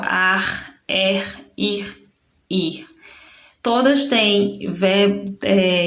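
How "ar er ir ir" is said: put-on endings read out for children; listing the endings. Shown as a demonstration. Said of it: "Todas têm R no final".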